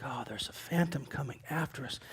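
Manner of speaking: very soft, low voice of dread; barely voiced, as if it might hear; hushed mock dread. Preacher voicing disciples spotting a phantom, hushed dread.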